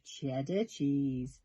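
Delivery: ordinary delivery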